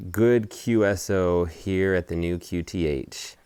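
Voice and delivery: usual speaking voice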